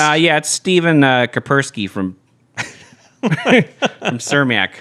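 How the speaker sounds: put-on broad Chicago accent, drawn-out ending; thick Chicago guy voice. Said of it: "do, like, a heavy Chicago accent".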